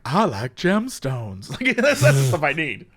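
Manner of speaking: a hint of the calm gemstone guy; gemstone ASMR guy. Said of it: "the one guy who's like".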